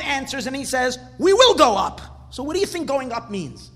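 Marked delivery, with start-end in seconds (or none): 1.2-2.0 loud, high rallying declaration; forceful answer. Acted. Teacher voices Kalev answering the people who refuse, forceful. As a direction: answer back firmly with voice raised.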